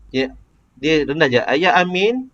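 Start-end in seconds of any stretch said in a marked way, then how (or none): 1.4-2.3 "Ayah Amin" climbing high on "Amin"; high pitch. Shown as a demonstration. Teacher showing the high part of the question, high pitch.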